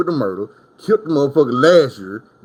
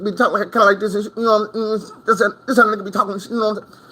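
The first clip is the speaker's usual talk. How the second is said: rapid, higher-pitched mock of a friend's speech; imitating fast talker; 8 semitones higher, faster than usual. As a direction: rattle it off fast and high like him.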